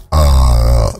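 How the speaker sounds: ordinary talk, nothing marked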